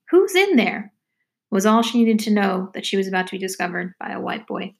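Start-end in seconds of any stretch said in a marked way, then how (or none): 0.1-0.9 high-pitched voice calling out; a stranger's high, questioning call; voice from the path. Acted. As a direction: call out high, falling at the end. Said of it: "The voice saying".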